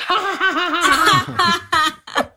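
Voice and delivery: high, loud canned "Ha, ha, ha" laughter; fake laugh track. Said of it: "And then you could just".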